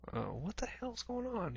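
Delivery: half-hushed, sensing something's wrong; alarmed confusion. Retold or imitated.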